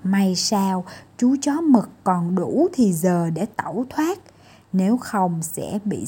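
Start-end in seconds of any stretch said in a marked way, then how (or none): none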